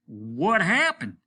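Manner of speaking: captain angrily demanding news; angry demand. Acted. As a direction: insist on the answer. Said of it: "chewed his cigar angrily".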